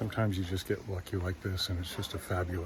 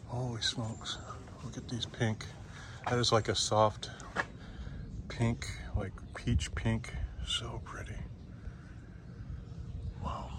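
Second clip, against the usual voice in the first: hushed awe; 7 dB quieter than usual. Real, not acted. Admiring pink flowers.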